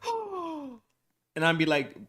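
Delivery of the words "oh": high, gasping mock surprise; a mock crowd gasping in shock. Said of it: "everybody gonna be like".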